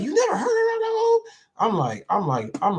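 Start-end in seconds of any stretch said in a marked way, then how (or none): 0.0-1.2 shrill, much higher incredulous cry, hammed up; mock disbelief. Imitating people amazed he doesn't know actors, mock shocked squeal. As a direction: mimic someone shocked you missed an old movie. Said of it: "people are like".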